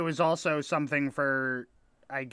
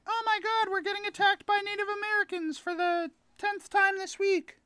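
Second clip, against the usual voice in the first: much higher, far-off hammed-up panic cry; mock panicked townsperson. Staged raid for train passengers, much higher hammed-up panic. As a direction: play a hysterical frontier townsfolk.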